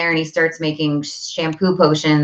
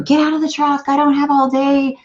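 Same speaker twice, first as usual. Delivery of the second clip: higher, frazzled mom barking orders; impatient snapping; 11 semitones higher than usual. Retold or imitated. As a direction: rush the kid out of the truck. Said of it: "freaking out at him".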